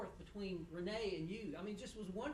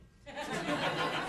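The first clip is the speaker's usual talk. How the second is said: laugh; 8 semitones higher, 9 dB louder than usual. Real, not acted. Laughs at wife joke.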